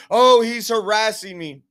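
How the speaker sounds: high, loud mock whine to police; mock complainer. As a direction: imitate someone complaining to the police. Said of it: "he talks to the police".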